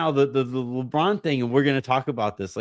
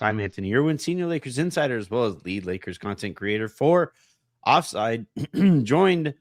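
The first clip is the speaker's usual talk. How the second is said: ordinary talk, nothing marked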